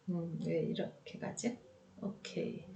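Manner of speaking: 혼잣말로 속삭이듯; 작게 중얼거리는 혼잣말; whispered aside. Real, not acted. Muttered aside while fiddling. (작업하다 혼자 속삭이는 말)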